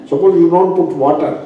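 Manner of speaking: usual speaking voice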